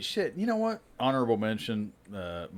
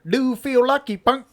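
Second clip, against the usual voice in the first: put-on high, loud Cambodian accent; 13 semitones higher, 6 dB louder than usual. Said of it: "like, a Cambodian guy".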